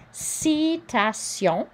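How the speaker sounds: Citation slow, ti said like si, nasal ending; careful French Citation; French pronunciation. Shown as a demonstration. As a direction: sound out Citation slowly in French. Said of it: "T I is like a C".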